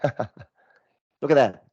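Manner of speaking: breathy laughing aside; real amused whisper. Real, not acted. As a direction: whisper it through a chuckle. Amused at the wrong answer.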